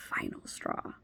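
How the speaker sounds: breathy whispered echo of final straw; whispered repeat. Real, not acted.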